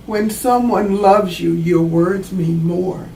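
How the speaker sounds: usual speaking voice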